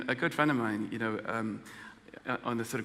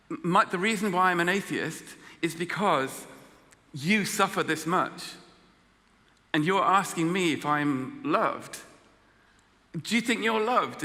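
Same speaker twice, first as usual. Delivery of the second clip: higher, louder challenging comeback; heated retort; 6 semitones higher, 4 dB louder, slower than usual. Retold or imitated. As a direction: push back at her, slowly. Retorting to the woman about suffering.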